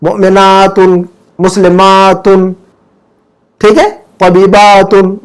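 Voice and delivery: slow, each word ending on a clear -तुन; -un endings spelled out. Shown as a demonstration. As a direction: drill मोमिनातुन, मुस्लिमातुन and तबीबातुन slowly, stressing the -un. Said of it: "मरफू में तो हम उन पढ़ेंगे".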